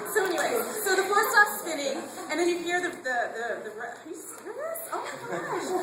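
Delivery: high, loud voice straining through tears; crying and half-laughing at yourself; real tearful choke-up. Real, not acted. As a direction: try to carry on, then break into tearful, laughing disbelief. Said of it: "now I'm gonna cry".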